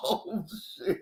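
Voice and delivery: real giggle breaking through; can't stop laughing. Real, not acted. Bursts out laughing on stream.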